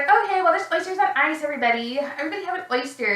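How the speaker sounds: mock hostess, pitched high then easing down; imitated chirpy hostess. Hostess offering oysters to everybody, imitated chirpy hostess. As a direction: put on a high party-host voice.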